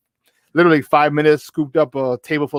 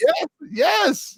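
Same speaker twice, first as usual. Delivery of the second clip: excited yes. Real, not acted. Excited agreement about Rosie Perez.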